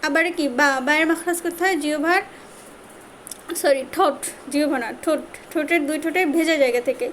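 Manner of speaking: ordinary talk, nothing marked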